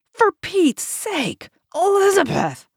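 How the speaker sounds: fed up, drawing it out; getting mad. A narrator's reading. Husband losing patience with his wife, exasperated. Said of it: "getting mad".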